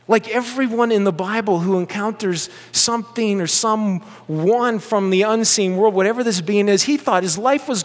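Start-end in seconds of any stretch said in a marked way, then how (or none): none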